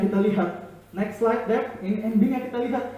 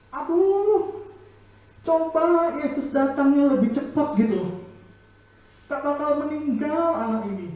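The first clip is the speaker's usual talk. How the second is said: lamenting mourner; 7 semitones higher than usual. Acted. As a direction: grieve aloud for the lost child. Mourners blaming Jesus for lateness.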